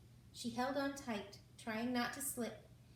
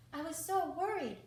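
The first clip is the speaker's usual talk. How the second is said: high, loud worried cry; cried out worried; 7 semitones higher, 6 dB louder than usual. A narrator's reading. Frog running up to her friend, crying out worried and high. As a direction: cry it out in relief, breathless. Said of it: "Hattie cried, huffing and puffing".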